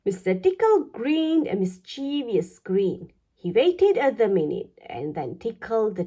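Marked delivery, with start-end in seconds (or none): none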